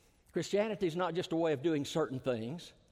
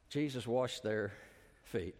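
hushed reveal; 8 semitones lower, 5 dB quieter, slower than usual. Real, not acted. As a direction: drop low and soft, slowing down. Dramatic pause in sermon climax.

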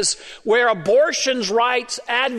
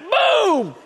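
mock gunshot boom; 11 semitones higher than usual. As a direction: shout the boom like a gunshot with a laugh.

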